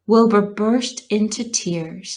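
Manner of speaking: usual speaking voice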